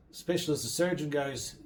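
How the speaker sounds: ordinary delivery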